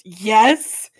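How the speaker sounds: loud defiant shout; hammed-up furious Jonah; mock furious yes. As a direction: yell it back like a sulky teen. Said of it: "Jonah goes".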